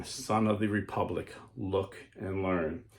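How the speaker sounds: slow hushed warning; hushed mysterious voice. Acted. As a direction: let it sink into a hush. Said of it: "the mysterious voice saying".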